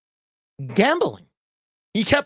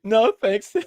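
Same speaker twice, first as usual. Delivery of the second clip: mock repeat; 6 semitones higher than usual. Shown as a demonstration. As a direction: toss the chat's "No, thanks" back, amused. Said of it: "Cozy Goober says".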